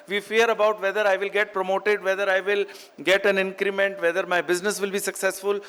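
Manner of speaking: ordinary talk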